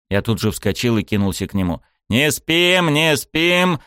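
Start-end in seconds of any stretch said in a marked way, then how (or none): 2.1-2.9 urgent shout, pitched up; yelling. Acted. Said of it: "заорал я на Рёдзи и Стайка". 2.9-3.8 urgent shout, pitched up; yelling. Acted. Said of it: "заорал я на Рёдзи и Стайка".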